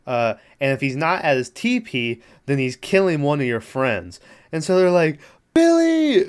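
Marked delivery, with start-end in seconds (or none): 5.5-6.2 high, loud mock holler for a friend; calling out. Retelling friends searching for their pal, mock calling out.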